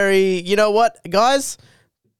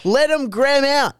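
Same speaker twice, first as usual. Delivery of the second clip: excited; 9 semitones higher than usual. Real, not acted. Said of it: "playful coinage, voice jumps".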